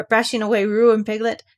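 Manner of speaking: ordinary delivery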